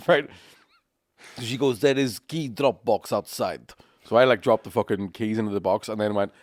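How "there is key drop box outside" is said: slow, exaggerated Russian accent; thick Russian accent. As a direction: spell it out slowly, heavily Russian. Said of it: "an accent that was so Russian".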